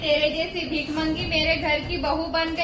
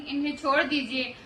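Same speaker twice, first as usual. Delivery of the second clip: usual speaking voice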